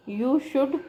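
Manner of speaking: ordinary talk, nothing marked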